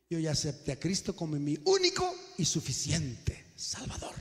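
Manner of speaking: soft whispered mock of a smug believer; mocking pious whisper. Preacher mocks smug believers, in a whisper. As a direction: whisper it like a self-satisfied convert, mockingly. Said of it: "Oh, qué bonito se oye".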